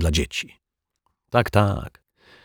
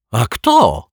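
higher, louder eager question; exclaimed, demanding question; children's cry; 5 semitones higher, 4 dB louder than usual. A narrator's reading. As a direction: call out like children in chorus. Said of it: "krzyknęły chórem dzieci".

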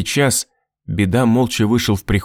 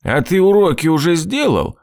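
raised, higher-pitched stern question; 7 semitones higher than usual. A narrator's reading. Drunk father questioning his son, stern. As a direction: demand an answer sternly. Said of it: "спросил Беда суровым голосом".

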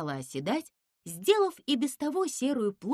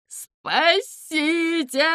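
high, loud, drawn-out scream; long high cry for rescue; yelling for help; 7 semitones higher, 8 dB louder, much slower than usual. A narrator's reading. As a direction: bawl it out desperately, stretching the vowels. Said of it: "хрипло орал Зыза".

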